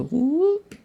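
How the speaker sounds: sudden high-pitched whoop; high squeak. Real, not acted. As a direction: pop up into a squeaky voice. Playful sound while dragging.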